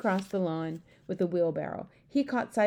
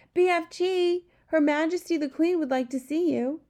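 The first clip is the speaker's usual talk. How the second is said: high, louder call toward the garden; 10 semitones higher, 4 dB louder than usual. A narrator's reading. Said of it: "called out".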